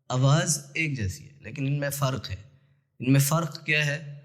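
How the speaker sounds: usual speaking voice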